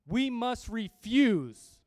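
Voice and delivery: "We must refuse" drawn out slowly, each word weighty; emphatic restatement. Real, not acted. Preacher drives the verse home to the congregation, slow emphatic stress. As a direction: hit "refuse" loud and long.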